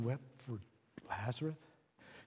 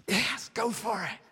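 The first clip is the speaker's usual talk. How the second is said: higher and slow, gentle encouragement; voice of God encouraging; 8 semitones higher, 4 dB louder, slower than usual. Retold or imitated. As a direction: let God's approval sound slow and lifted. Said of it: "but he was saying".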